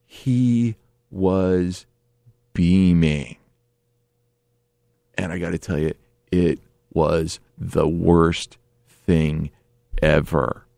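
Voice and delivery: low, drawn-out, word by word; slow dramatic emphasis. Real, not acted. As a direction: spell out the dread slowly. Dramatic deadpan climax.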